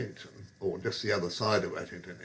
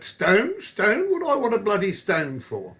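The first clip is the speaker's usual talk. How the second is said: much higher, loud incredulous repeats; baffled bloke; 10 semitones higher, 5 dB louder than usual. Retold or imitated. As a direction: sputter in disbelief at the stone. Bloke baffled by returned stone.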